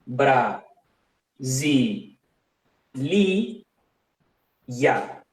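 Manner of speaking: "bra, zi, li, ya" in separate beats; syllable by syllable. Shown as a demonstration. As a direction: break "bra, zi, li, ya" apart with long pauses. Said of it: "si vamos a la pronunciación". ("bra, zi, li, ya" separado sílaba por sílaba)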